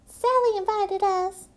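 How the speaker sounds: high, loud little duck voice; duck sister voice. Acted. A duck sister answering the turkeys, high and loud. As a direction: pipe it up bright as a duck sister. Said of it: "Mandy replied".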